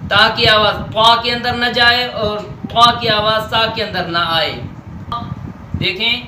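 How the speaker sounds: ordinary talk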